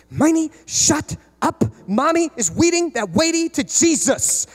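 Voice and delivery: lightly voiced daughter hushing her sister; big sister scolding. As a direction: scold like a seven-year-old. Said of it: "she goes".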